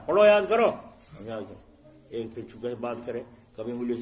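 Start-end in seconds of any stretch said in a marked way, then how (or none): 0.1-0.7 loud, high shout of a teacher ordering study; angry teacher's shout. Retold or imitated. Teacher yelling at a distracted pupil, loud angry shout. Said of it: "تو استاد نے غصے میں". 1.2-1.9 low, soft trailing repeat of the order; teacher's dropped mutter. Retold or imitated. Teacher repeating to a distracted pupil, low soft grumble. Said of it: "تو استاد نے غصے میں".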